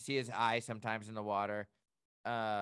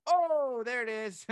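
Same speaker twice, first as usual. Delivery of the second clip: mock surprise; 10 semitones higher, 6 dB louder, slower than usual. Retelling whale surfacing suddenly.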